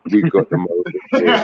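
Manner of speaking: real laughter on the line; laughing. Real, not acted. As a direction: laugh the words out at your own joke. Laughs at his own joke.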